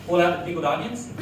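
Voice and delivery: normal talking voice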